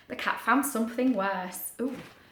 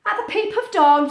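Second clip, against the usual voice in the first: bright storytelling; 10 semitones higher, 7 dB louder than usual. A narrator's reading. Storyteller lifts for new scene.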